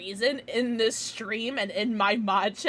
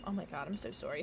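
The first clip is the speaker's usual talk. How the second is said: usual speaking voice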